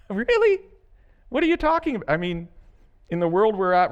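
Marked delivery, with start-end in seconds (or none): 0.1-2.1 much higher incredulous really; hammed-up disbelief; mock disbelief. Disbelieving disciples voiced.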